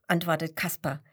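usual speaking voice

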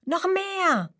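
hoch und begeistert gerufen; begeistert rufen. A narrator's reading. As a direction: voller Freude nach mehr rufen. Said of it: "Leon ruft begeistert". (Leon bettelt begeistert um weitere Witze)

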